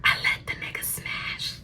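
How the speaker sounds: hushed, very soft confession; whisper. Real, not acted. Talking low so mom won't hear, whispered. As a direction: whisper it so nobody overhears. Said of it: "let me talk low".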